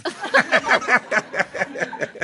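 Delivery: silly smitten giggle; imitated giggle. Comic recalling praise from the handsome husband, smitten giggle. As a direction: giggle like someone with a crush. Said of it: "like, giggled".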